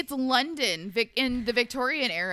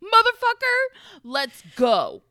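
outraged shout; 6 semitones higher, 5 dB louder than usual. Real, not acted. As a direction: blow up in mock fury. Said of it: "I'm so angry".

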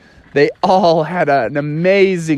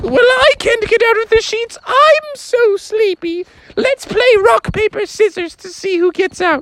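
sleepy mom voice. Retold or imitated. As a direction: whine it like a tired frog mom. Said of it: "Mother was like".

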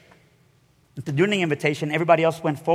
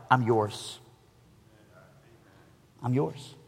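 tender surrender; 5 semitones lower, 9 dB quieter, much slower than usual. Retold or imitated. Surrender prayer retold.

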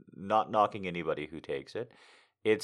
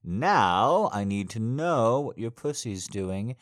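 seductive daddy. Acted. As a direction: coax her slowly, in control. Dominant daddy erotic roleplay.

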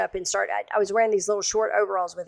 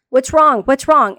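loud, raised, panicked shouts; alarmed husband; 5 semitones higher, 9 dB louder, slower than usual. Retold or imitated. Husband running out to screaming wife, alarmed. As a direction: imitate a husband calling out in alarm.

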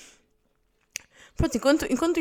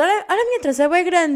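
high, loud imitated little girl; kid showing off; 6 semitones higher, 6 dB louder than usual. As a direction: imitate a girl proud of her braid. Child showing off her braid. (imitação de miúda a exibir-se)

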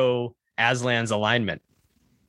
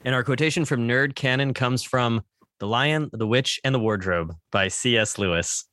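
usual speaking voice

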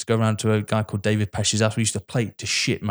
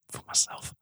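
whispered villain reply; 10 dB quieter than usual. Retold or imitated. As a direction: breathe the line like the film villain. Quoting Trevelyan's reply.

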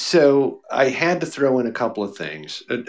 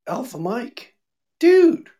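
excited greeting; 13 semitones higher, 4 dB louder than usual. Real, not acted.

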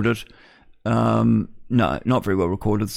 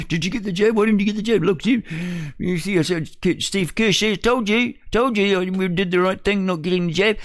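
high, needling mock relative; smug told-you-so at the dinner table; mock relative arguing; 8 semitones higher than usual. Mock family Christmas jab argument.

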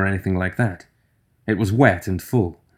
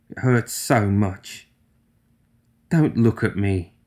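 tearful; slower than usual. Acted. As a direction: barely get the words out. Fiona sobbing after outburst.

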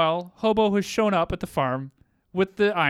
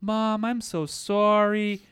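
slow, high, drawn-out mock sorrow; hammed-up daughter's lament, pitched high; badly acted apology; 5 semitones higher, much slower than usual. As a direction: imitate stilted acting, stretching each word. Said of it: "It's her worst acting of the episode".

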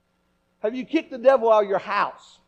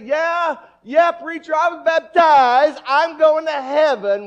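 loud, higher mock churchgoer shouting, slow at the end; boastful churchgoer; 7 semitones higher, 10 dB louder, slower than usual. Preacher mimics a complacent member, loud mock boast. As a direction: brag loudly about being baptized. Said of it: "how easy it is to say".

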